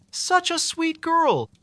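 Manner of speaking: put-on high, slow old woman's voice; fond, sorrowful old lady; elderly librarian. A narrator's reading. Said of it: "with a sad shake of her head".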